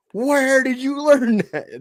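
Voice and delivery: very high, slow, loud shocked squeal; hammed-up appalled father; outraged dad. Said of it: "the dad's like".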